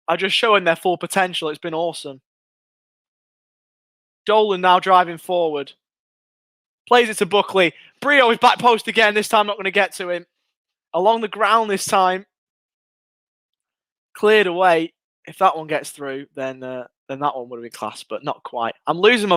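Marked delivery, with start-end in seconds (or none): 4.2-14.9 quick, lifted match commentary calling each pass; live play-by-play. Acted. Fan calling the Rovers attack live for stream viewers, excited play-by-play. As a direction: call the move as it unfolds.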